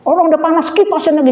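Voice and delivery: high, mock-stern God voice; God scolding. Preacher voices God rebuking a troublemaker, mock-stern and high. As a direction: play God catching a gossip fanning the flames. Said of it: "Tuhan lihat".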